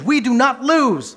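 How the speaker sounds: loud, slow and high, like a coach firing up the team; coach's rallying cry. Retold or imitated. Coach to his team before the game, a loud slow rally. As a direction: bellow it at the locker room. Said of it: "Coaches tell their teams".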